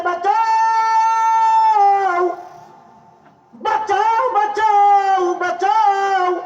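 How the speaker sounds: very high, drawn-out cry for help; loud wailing shout from a nightmare; screamed plea. Acted. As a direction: scream it out, holding each word long. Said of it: "চিৎকার মেরে বলতেছে".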